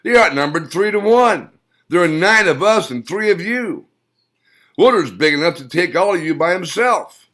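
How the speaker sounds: high, loud mocking old man voice; loud jeering old man. A narrator's reading. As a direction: taunt him with a scornful cackle. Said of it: "Old Man Schroeder laughed at Steve".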